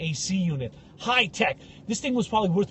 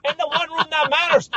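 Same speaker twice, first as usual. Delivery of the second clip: mock outrage; 10 semitones higher, 7 dB louder than usual. Indignant rant about the cabin.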